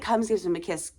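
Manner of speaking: normal talking voice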